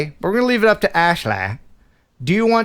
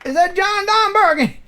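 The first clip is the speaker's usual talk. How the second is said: excited recognition. Real, not acted.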